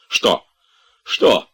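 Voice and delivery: menacing. A narrator's reading. Master roused by servant's roar, menacing. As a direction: fire back a stern challenge. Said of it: "грозно заговорил Обломов".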